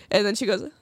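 ordinary talk, nothing marked

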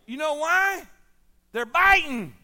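loud, high punch on the question; preaching shout. Acted. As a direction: shout it out to the church. Preacher rouses the congregation.

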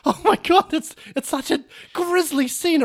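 put-on a kid stumbling on something horrible; shocked orphan boy.

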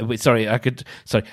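usual speaking voice

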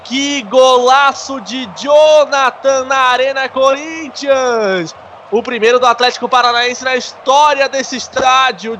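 high, slow, drawn-out goal call; explosive goal commentary. Real, not acted. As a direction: keep the goal euphoria going.

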